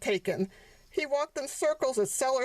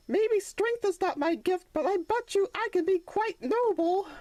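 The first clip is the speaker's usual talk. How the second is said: put-on young donkey, undeterred; 9 semitones higher than usual. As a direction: shrug off the failure and try again. Sonny after failing.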